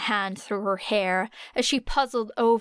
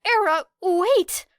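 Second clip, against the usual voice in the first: put-on high, loud boyish call; high, shouting young boy; 10 semitones higher, 6 dB louder than usual. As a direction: call after her urgently, pitched up. Midoriya calling after her.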